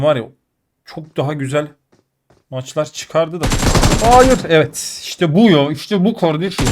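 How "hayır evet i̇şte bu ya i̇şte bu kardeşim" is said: loud, high outburst of triumph; excited celebration. Real, not acted. Gamer to viewers on winning the match, excited and triumphant. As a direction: burst out louder, pitch jumping up on the win. Celebrates getting the last kill.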